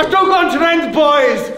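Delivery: very high, shouted mock British accent; attempted British accent. Showing the boys his British, shouted bad British accent. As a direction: yell it in over-the-top fake British. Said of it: "Say it in a British accent".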